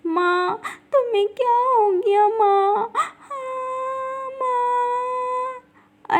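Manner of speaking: put-on high, soft, sobbing little girl; a frightened daughter weeping over her mother; drawn-out tearful wail, very soft; child crying. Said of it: "छोटी अपनी माँ को देखकर रो रही है".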